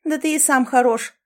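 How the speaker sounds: high, argumentative comeback; bickering retort. A narrator's reading. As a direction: bicker back. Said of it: "начала пререкаться Лори". (задиристо огрызаясь)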